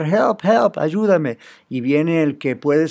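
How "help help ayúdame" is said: a higher, half-acted call of Help, help from a drowning man; mock cry for help. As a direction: pastor voicing a drowning man calling for rescue, high mock cry. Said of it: "empieza a gritar".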